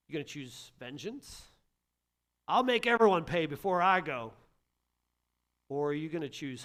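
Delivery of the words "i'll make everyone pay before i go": louder, mock vengeful vow; hammed-up grudge; vengeful threat. Pastor voicing someone who chooses vengeance, mock threat.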